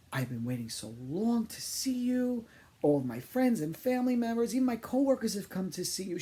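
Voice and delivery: gushing fan. Retold or imitated. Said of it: "she then proceeded to say".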